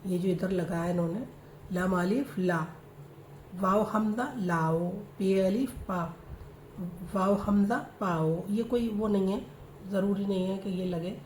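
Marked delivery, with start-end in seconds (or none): 1.7-8.5 slow, syllable by syllable, each letter named; slow letter spelling. Shown as a demonstration. Teacher slowly spells Urdu letters out for her students. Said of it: "spelling letters out for students".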